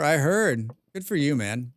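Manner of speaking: ordinary talk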